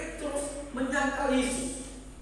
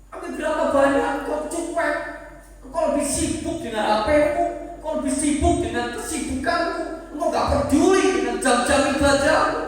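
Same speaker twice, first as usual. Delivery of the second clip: louder, rising to a hard rebuke; preaching rebuke; 6 semitones higher, 8 dB louder than usual. Real, not acted. Preacher scolding the congregation for neglecting worship, loud rebuke. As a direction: call them out on their neglect.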